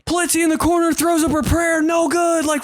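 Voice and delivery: high-pitched, amped-up play-by-play call; sports announcer voice. Retold or imitated. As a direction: call the backyard game like a TV announcer. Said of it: "his dad would be like".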